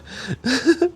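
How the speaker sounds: very high giddy giggle; a squeaky delighted giggle; giddy laugh. Acted. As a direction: giggle out of pure relief. Giddy after confession.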